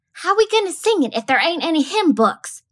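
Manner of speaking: high, indignant question; lightly voiced girl objecting; protesting child. A narrator's reading. Scout to Calpurnia, a high indignant protest.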